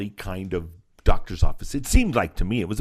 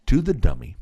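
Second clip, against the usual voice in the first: usual speaking voice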